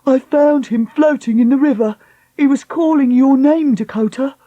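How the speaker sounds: breathless report; anxious man voice. Acted. Henry reports finding him.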